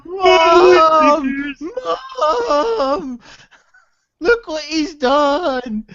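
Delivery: hammed-up sobbing shout for mum, slow at the end; fake-crying little boy; mock crying child. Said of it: "You were crying in the pool of your own fucking tears".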